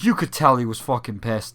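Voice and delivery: usual speaking voice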